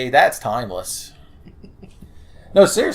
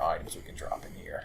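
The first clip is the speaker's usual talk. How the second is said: whispering; 7 semitones lower, 14 dB quieter than usual. Real, not acted. Whispering in game.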